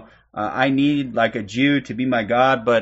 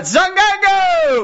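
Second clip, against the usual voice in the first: excited shout-out; 5 dB louder, much slower than usual. Real, not acted. Host hyping a viewer's name to the chat, excited. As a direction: pitch jumps way up on the name, slow and louder.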